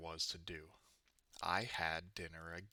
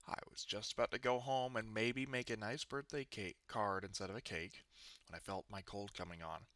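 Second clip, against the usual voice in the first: ordinary delivery, nothing marked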